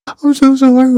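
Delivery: high, mock-panicked apology; hammed-up frightened plea; mock terrified recruit. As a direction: squeak it out like a scared kid.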